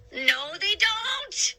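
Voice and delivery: high, slow, drawn-out shout. A narrator's reading. Sailor's other head contradicting, alarmed shout. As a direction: cut them off in alarm. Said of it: "Pepper shouted".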